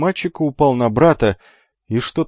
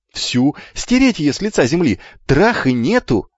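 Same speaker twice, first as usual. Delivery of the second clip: put-on raised, vehement boyish cry; eager shouting; 5 semitones higher, 4 dB louder than usual. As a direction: cry it out with relish. Twins shout eager answer.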